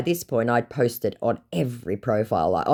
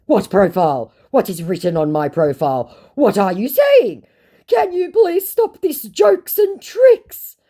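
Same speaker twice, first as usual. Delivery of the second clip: high, loud bewilderment building; exaggerated plea, climbing to very high; mock scammer; 9 semitones higher, 9 dB louder than usual. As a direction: get shriller as the flustered scammer. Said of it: "said my scammer".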